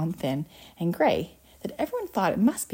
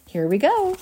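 high, louder burst of eagerness; eager, raised-voice announcement; excited cry; 9 semitones higher, 5 dB louder than usual. Acted. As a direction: cry it out, pitched up. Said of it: "cried Mister Wonka".

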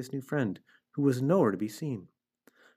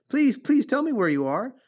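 high, loud, frantic begging; excitable stammering outcry; screamed plea; 13 semitones higher, 4 dB louder than usual. A narrator's reading. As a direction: plead urgently, pitched right up. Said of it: "screamed Wilbur".

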